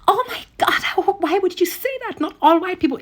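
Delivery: imitating someone scandalized, voice way up high. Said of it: "everybody's like".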